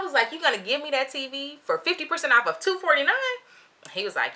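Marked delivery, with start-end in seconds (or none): 0.3-3.1 high-pitched, disbelieving question; incredulous. Retold or imitated. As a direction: check a deal too good to be true. Disbelieving reply to the discount.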